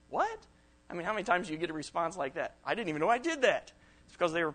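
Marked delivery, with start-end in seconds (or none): 0.1-0.5 sharp, much higher startled "What?"; surprised. Retold or imitated. Speaker voicing a friend caught off guard, startled high "What?". 2.6-3.7 higher, louder, innocent surprise; surprised reply. Retold or imitated. Speaker voicing a friend who didn't realize, higher and surprised.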